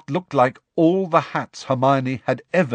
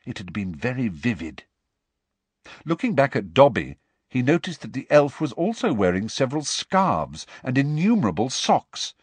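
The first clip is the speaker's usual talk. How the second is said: plain reading voice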